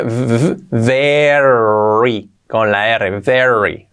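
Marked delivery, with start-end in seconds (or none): 0.7-2.3 "very" with a long buzzing V and drawn-out R; slow "vvverrry" on a voiced V; V sound. Shown as a demonstration. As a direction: stretch "very" out slowly, sound by sound. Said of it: "la pronunciación con la V".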